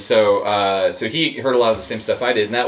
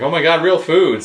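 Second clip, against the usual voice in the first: higher, louder burst of delight; retold delighted exclamation; 7 semitones higher, 6 dB louder than usual. Congoer thrilled by roommate's sandwich fixings, delighted. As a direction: exclaim it as if saved from snack bars.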